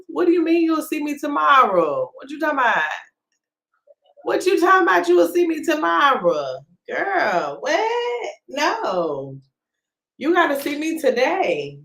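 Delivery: high-pitched playful protest; mock indignation. Host to viewer who said see you tomorrow, mock indignant. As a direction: act offended, pitch jumping up.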